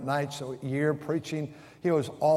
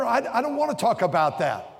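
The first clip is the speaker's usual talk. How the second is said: mock refusal; 7 semitones higher, 3 dB louder than usual. Imitating friend refusing politics.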